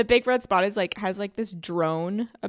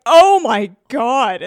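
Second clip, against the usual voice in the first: stunned; 4 semitones higher, 12 dB louder, much slower than usual. Retold or imitated. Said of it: "that part".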